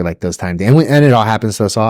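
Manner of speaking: usual speaking voice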